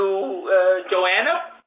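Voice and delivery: ordinary delivery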